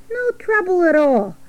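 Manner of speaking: high rat squeak; high little rodent voice. Acted. Rat figure replies.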